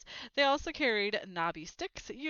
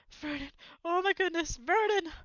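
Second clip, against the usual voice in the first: loud, much higher panicked cry; shocked cry; 9 semitones higher, 6 dB louder than usual. A narrator's reading. Aunt crying out to her husband in shock, loud and high. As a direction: gasp it out in alarm.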